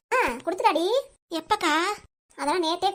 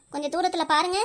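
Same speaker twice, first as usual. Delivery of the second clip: ordinary talk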